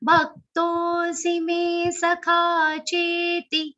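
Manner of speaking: "cheti" chanted slow with the final i cut short; short final syllable. Shown as a demonstration. Teacher showing students Gita recitation, syllables cut short. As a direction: chant it slowly, clipping the last "cheti". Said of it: "Cut it short".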